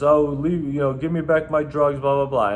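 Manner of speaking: lightly mocking whiny plea; hint of a pestering complainer; protesting clubgoer. Bouncer mimics ejected man's plea.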